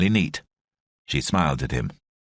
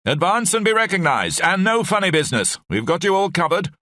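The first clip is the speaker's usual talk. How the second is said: a home counties English officer calling out, raised and high; an English officer ordering strangers forward in a home counties voice; 12 semitones higher than usual. A narrator's reading. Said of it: "English this time, home counties".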